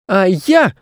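put-on high-pitched angry child voice; angry boy. A narrator's reading. Boy answering back to an old woman, angry. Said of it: "от злости сам не понимая".

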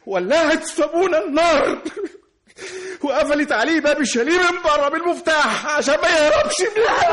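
very high, loud outraged cry; screamed accusation. Acted. Brother accuses sister of burning their father, screaming in outrage. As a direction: shout it out at the top of your range.

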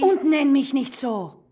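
slow, loud snap; angry. Acted. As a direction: cut her off hard. Snapping at the nickname.

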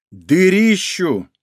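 Дырищу stretched out slowly; slow emphatic correction. Acted. Exaggerating the word дыру.

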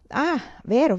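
vero rising high, sudden; oh right, high and bright; remembering. A narrator's reading. (vero, acuto e in salita)

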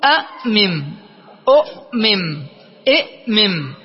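أأمم، أؤمم، إئمم as a'-mim, u'-mim, i'-mim; split-up word forms. Shown as a demonstration. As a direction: sound out أأمم، أؤمم، إئمم with a gap after each hamza. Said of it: "فستقول".

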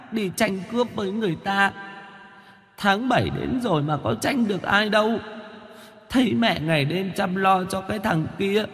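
put-on high, soft whimpering child ghost; a hungry ghost complaining tearfully to mother. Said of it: "Con quỷ mếu máo".

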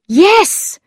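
bright-pitched burst of realisation; crying out. A narrator's reading. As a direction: cry it out in sudden recognition. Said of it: "he cried".

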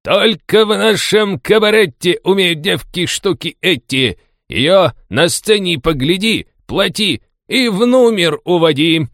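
loud, high barker's rhyme; clumsy rhyming patter. A narrator's reading. Street tout advertising cabaret girls to passing sailors, clumsy loud rhyming. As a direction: belt out a crude advertising verse. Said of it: "неуклюже рифмуя".

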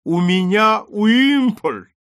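high, loud, slow proud announcement; solemn triumph. A narrator's reading. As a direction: announce a great guest grandly. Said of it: "торжественно промолвил". (торжественно, выше, громче и медленно)

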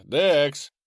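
loud call across the house. Acted. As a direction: shout his name to the next room. Calling friend from another room.

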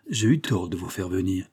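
ordinary delivery, nothing marked

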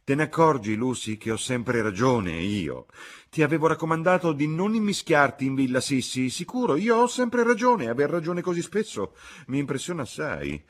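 high, loud told-you-so; a mother crowing over her daughter; triumphant scolding. A narrator's reading. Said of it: "gridò la signora Honeychurch".

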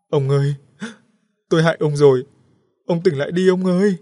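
high, loud wailing plea. A narrator's reading. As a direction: wail over the unconscious husband. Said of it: "khóc ngất lên". (vợ khóc ngất gọi chồng)

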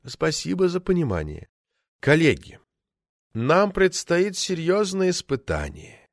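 high, slow, solemn address; aspirated delivery. A narrator's reading. Orator warning colleagues of a serious trial, with his famous aspiration. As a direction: speak with portentous breath on the words. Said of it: "со своим знаменитым придыханием".

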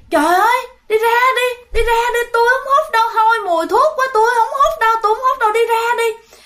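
put-on very high, fast, sing-song wailing like a child; childlike crying girl. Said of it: "bạn lại khóc lên mà bạn giãy nảy giống như là một đứa con nít".

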